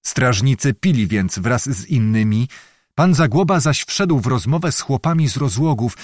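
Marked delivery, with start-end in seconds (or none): none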